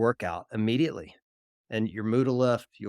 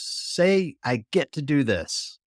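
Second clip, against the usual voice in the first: sharp raised call, then a slow firm line; coach shouting; 6 semitones higher, 3 dB louder, much slower than usual. Retold or imitated. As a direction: bark the order up high, then slow it down. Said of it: "he shouts at me".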